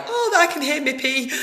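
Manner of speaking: high-pitched mimicry with a laugh; imitated friends. Friends tell her they heard her, imitated in a high voice.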